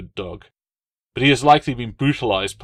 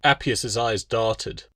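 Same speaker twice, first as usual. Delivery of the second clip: usual speaking voice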